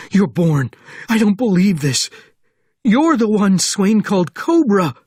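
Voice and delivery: high, loud, frantic shouting; panicked disbelief. A narrator's reading. Sergeant recognising Bourne as Cobra, panicked shouting. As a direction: cry out in horrified recognition.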